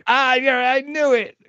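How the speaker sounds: high, gleeful "I called it"; delighted recognition. Real, not acted. Host learns guest is a Jersey boy, delighted. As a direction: burst out in real delight, pitch way up. Delighted his hunch was right.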